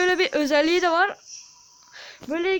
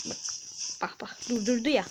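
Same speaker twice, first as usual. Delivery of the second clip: normal talking voice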